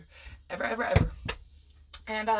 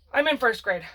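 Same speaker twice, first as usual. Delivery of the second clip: ordinary talk, nothing marked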